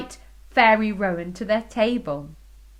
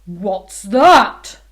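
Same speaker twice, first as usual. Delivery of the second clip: loud king's bellow; 11 dB louder than usual. Acted. King bellowing at the noise behind the chair. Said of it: "bellowed the king".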